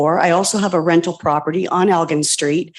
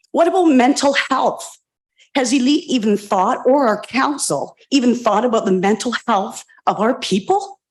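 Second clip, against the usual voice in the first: ordinary delivery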